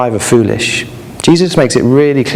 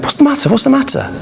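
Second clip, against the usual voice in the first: high, concerned parent voice; worried parents; 13 semitones higher than usual. A narrator's reading. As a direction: ask anxiously like a worried parent.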